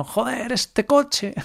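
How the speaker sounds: high, slow mock rage at the car; hammed-up frustrated driver; frustrated outburst. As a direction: pretend to curse the broken car. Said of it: "empiezo a dar golpes contra el volante".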